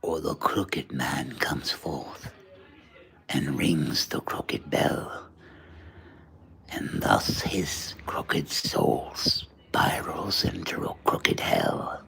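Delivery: eerie slow whispered curse; spooky whisper. Pretend. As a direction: breathe it like a curse. Spooky rhyme for subscribers.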